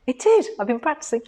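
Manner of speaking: high-pitched gleeful reply; amused, about to laugh. Real, not acted. Wife answering husband's tease, gleefully amused. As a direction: squeak it out before bursting into laughter.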